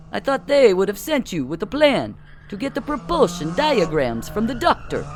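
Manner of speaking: put-on Russian spy speaking in a Russian accent. A narrator's reading. Don the spy to Bill in a Russian accent. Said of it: "a distinct Russian accent emerging".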